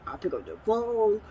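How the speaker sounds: ordinary talk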